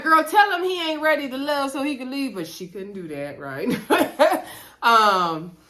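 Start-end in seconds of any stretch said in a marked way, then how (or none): 0.0-2.3 fired-up cheering. Real, not acted. Hyping up cast member from couch.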